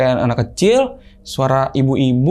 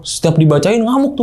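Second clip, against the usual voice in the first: ordinary delivery, nothing marked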